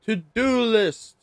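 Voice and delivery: "to-do list" slow, high and loud; drawn-out emphasis. Pretend. Reading slide, emphatic.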